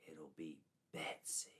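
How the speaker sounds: low, soft whispered threat; witch whisper. A narrator's reading. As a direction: whisper the doom. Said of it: "the witch said to him".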